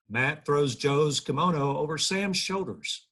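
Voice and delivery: usual speaking voice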